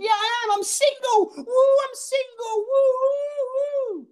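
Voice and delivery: very high, hammed-up squeal; whooping single girl. Girl in front row celebrating being single, squealing. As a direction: imitate a tipsy girl celebrating shrieking high. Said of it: "She went".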